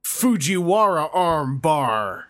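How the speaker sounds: slow drawn-out ring announcer call. Pretend. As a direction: call the move like a wrestling announcer. Announcing a wrestling move.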